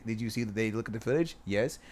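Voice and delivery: ordinary talk, nothing marked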